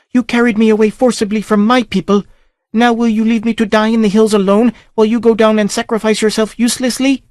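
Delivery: high female voice, accusing; princess, reproachful. A narrator's reading. Princess reproaches Conan for leaving her, high and hurt. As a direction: raise the voice high and reproach him.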